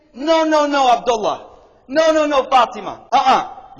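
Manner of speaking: loud, insistent mock objection; neighbor's protest. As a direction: object firmly, punching each refusal. Neighbor acted protesting a move.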